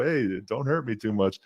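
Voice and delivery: ordinary talk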